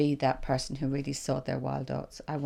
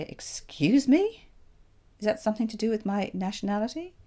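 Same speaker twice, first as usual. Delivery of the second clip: shocked challenge; 4 semitones higher, 5 dB louder than usual. Pretend. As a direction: rise up in disbelief, stretching the words. Shocked retort she never said.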